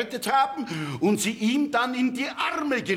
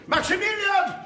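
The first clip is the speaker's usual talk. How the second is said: urgent shout; 8 semitones higher than usual. Acted. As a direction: yell for them to come quickly. Calling out urgently for help.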